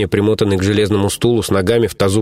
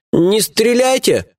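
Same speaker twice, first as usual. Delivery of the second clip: испуганная мольба, медленно и высоко; умоляй не стрелять, трясясь от страха; дрожащим голосом; 13 semitones higher, much slower than usual. Acted. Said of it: "дрожащим голосом попросил бандит". (испуганно умоляя)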